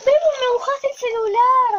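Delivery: high loud complaint about the wet phone; upset shout. Real, not acted. Kid splashed her phone.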